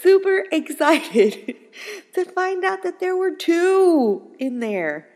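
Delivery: high, loud, drawn-out glee through laughter; laughing excitement. Retold or imitated. Storyteller recalling her mother-in-law's joy at twins, laughing excitement. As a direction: squeal it slowly while laughing, stretching the key word.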